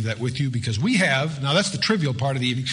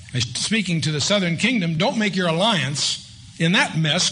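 ordinary talk

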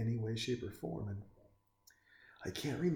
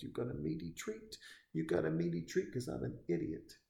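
higher, goofy dim-witted dog; dumb dog voice; 7 semitones higher than usual. Retold or imitated. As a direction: voice the Basset Hound as a lovable idiot. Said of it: "That dog would look at you and be like".

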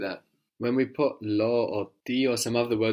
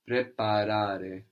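slowly, every syllable clear; slow and careful; slower than usual. Shown as a demonstration. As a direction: draw the word out for the learner. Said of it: "So we have an A there".

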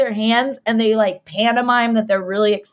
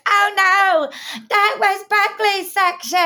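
high, hammed-up tearful wail; exaggerated high sing-song lament; mock wailing; 9 semitones higher, slower than usual. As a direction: imitate a heartbroken girl bawling. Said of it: "starts crying".

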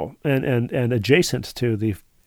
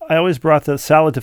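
ordinary delivery

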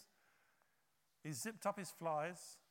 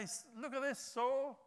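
louder, higher scornful jeer; mock the king like a braggart; hammed-up sneering gloat; mock taunting; 5 semitones higher, 5 dB louder than usual. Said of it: "He doesn't say".

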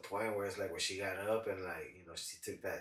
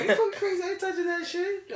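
laughing refusal; 7 dB louder than usual. Retold or imitated. Friend refusing, retold laughing.

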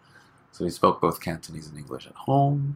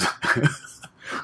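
breathy laugh; 7 semitones higher than usual. Real, not acted. Laughs mid-sentence.

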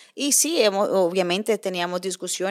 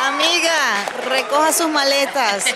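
louder, raised-pitch pronouncement; loud verdict; 3 semitones higher, 5 dB louder than usual. Acted. Host ruling on the listener's case, loud and high. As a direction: declare it like a TV judge.

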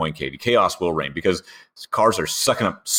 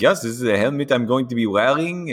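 imitated French accent, higher pitched; Esteban; 6 semitones higher than usual. Imitating a driver showing his helmet, French accent. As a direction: put on a playful French accent. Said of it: "I love your Esti accent".

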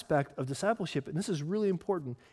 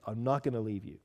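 ordinary delivery

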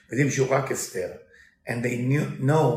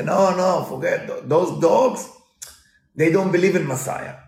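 loud hurried excuse; flustered wolf; 6 semitones higher, 6 dB louder than usual. Retold or imitated. Wolf explains the dogs away to chicken, loud. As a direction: brush it off loudly.

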